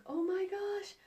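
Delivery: high, dismayed "oh my gosh"; sudden alarm at the situation. Retold or imitated. Mom realizing it's already coming, high alarmed gasp. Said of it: "So I'm like".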